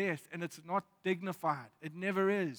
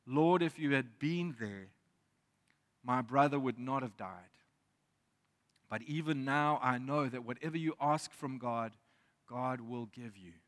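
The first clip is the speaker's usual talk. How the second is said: scripture reading; 5 semitones lower than usual. Acted. Scripture read aloud in sermon.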